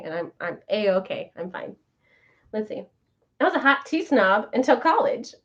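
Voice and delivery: ordinary delivery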